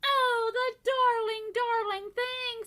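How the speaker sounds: high, slow adoring voice; wife adoring the piglet; doting coo. A narrator's reading. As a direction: melt over the piglet. Wife cooing.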